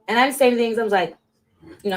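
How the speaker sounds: ordinary talk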